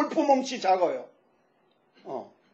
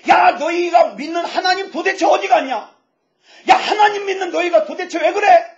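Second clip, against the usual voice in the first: raised, high-pitched jeering shout; mocking taunt; 6 semitones higher, 5 dB louder than usual. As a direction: act out an enemy loudly deriding their faith. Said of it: "막 하나님을 조롱해요".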